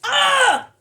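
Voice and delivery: short high, loud squeal; excited yelp; delighted shriek. Real, not acted. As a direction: shriek with sudden delight, breaking into laughter. Startled delight at next card.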